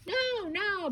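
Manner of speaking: very high, protesting storybook shout; exasperated high-pitched cry. A narrator's reading. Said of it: "Boris shouted".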